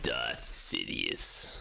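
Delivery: low, slow, hoarse Sith whisper; low slow Emperor rasp; Darth Sidious voice. Pretend. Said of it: "Oh, I'll do that voice".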